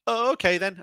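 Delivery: loud, high mock-naive agreement; easily persuaded Magnus. Narrator voicing Magnus giving in to the voice, mock-naive.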